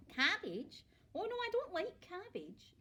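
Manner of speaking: put-on small boy protesting to grandma, whiny; dismayed little boy. A narrator's reading. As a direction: whine it like a boy who hates cabbage. Boy's dismay at cabbage.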